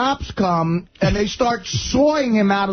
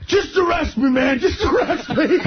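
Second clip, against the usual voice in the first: high-pitched, exasperated begging; mock pleading; 11 semitones higher than usual. Drunk driver begs the cops to arrest him, mock pleading. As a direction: act out a drunk giving up in a much higher voice.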